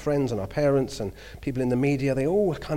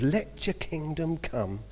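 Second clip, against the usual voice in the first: reverent quote; 2 semitones higher, 6 dB quieter, much slower than usual. Acted. Quoting the Lord's Prayer.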